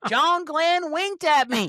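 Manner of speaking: very high, slow swooning drawl; Southern accent. Pretend. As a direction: stretch it out, swooning Southern belle. Playful mock Southern astronaut bit.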